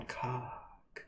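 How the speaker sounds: mock-seductive come-on; sexy voice. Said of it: "Oh, sexy voice".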